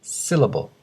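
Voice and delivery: "syllable" with the stress on SYL; teacher's model of "syllable", front-stressed; first-syllable stress. Shown as a demonstration. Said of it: "the stress syllable is the first".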